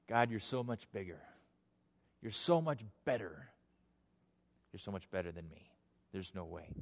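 soft, low confession of smallness; humbled surrender. Acted. Man who wanted to argue with God gives in, humbled and hushed. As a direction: back down, voice sinking away almost to nothing.

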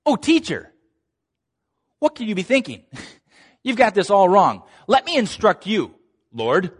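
mock condescension. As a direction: talk down to the Lord, hammed-up. Mock Peter lecturing Jesus.

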